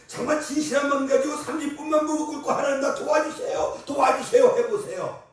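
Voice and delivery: loud, high fervent exhortation; fervent preaching. Acted. Preacher urging the congregation to kneel and pray, fervent. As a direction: press them to pray in earnest.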